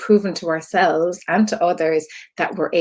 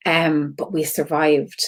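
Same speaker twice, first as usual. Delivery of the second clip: ordinary delivery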